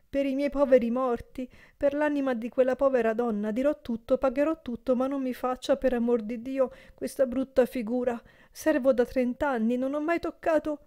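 frightened servant begging his master; desperate pleading. A narrator's reading. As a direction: plead for mercy, voice up high.

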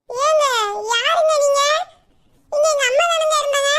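put-on high, loud girlish voice asking who this is; puzzled daughter. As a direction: act baffled as the young daughter. Daughter voice, mock confusion.

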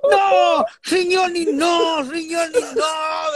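high, loud mock panic; hammed-up desperate yell in broken Spanish; panicked shout. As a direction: shout it, pleading. Acted cook yelling in panic.

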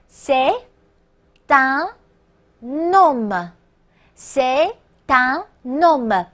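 "c'est un homme" word by word, linking T into un and N into homme; slow liaison demo. Shown as a demonstration. Teacher asks students to listen, slow linked "c'est un homme". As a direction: stretch "c'est un homme" slowly, sounding "c'est tun nomme". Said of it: "前面依然要连读，听我读啊".

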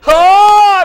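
long, high, very loud Oh; exultant shout. Real, not acted. Preacher rejoicing before congregation that mercy kicks in, exultant shout. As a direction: let out a preacher's jubilant holler.

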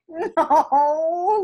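ordinary delivery

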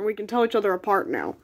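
normal talking voice

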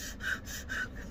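heavy voiceless huffs; acted fuming breaths; pissed-off huffing. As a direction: breathe hard out of anger. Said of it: "'cause I was pissed".

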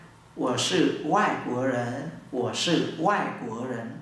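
slow, deliberate model phrase; slow Mandarin: I'm a foreigner; slow demo. Shown as a demonstration. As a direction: say it slowly so they slow down. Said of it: "nói tốc độ chậm".

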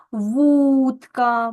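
"вудка" with a distinct Д, never Т; clearly voiced consonant. Shown as a demonstration. Teacher shows pupils a clear Д, clearly voiced consonant. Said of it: "чітко у нас вимовляються дзвінки".

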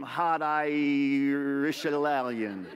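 very slow, stretched words; milk the punchline slowly; comically drawn out. Acted. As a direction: draw each word way out for the joke. Joke on mixed hot-blooded heritage.

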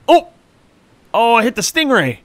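high-pitched cry of surprise; surprised, pitch jumping well up; startled exclamation. Real, not acted. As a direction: blurt it out in sudden alarm. Startled at hitting the stingray.

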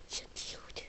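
barely-there whisper; whispered aside. Real, not acted. Admiring a doll in passing.

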